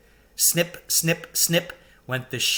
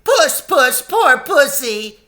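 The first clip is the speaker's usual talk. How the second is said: high, loud sing-song mockery; jeering taunt; 13 semitones higher, 10 dB louder, slower than usual. A narrator's reading. Crowd taunting the lion, high and loud. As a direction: coo at him like a pet, mocking. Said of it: "jeering at him".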